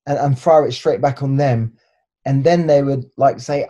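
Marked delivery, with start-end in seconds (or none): none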